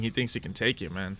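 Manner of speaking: usual speaking voice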